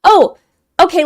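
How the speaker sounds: loud, high "oh" as it clicks; real aha moment; sudden realization. Real, not acted. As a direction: get it all at once, voice jumping up. Suddenly understands a pronunciation hint.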